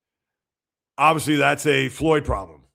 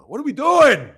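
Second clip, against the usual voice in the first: exasperated; 9 semitones higher, 6 dB louder than usual. Real, not acted. Exasperated rant about clowns.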